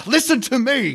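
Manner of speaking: fed-up officer yells at reporter; exasperated shout. Acted. Officer losing patience.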